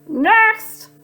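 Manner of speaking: high squawked order; shrill bird call; parrot squawk. Acted. Parrot calling the next rascal, squawking. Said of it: "blared the parrot".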